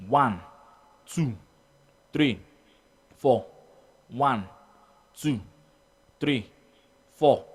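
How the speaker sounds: one number per beat, evenly spaced; steady clock-paced count. Shown as a demonstration. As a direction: count aloud in time with a second hand. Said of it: "use your mouth to count it".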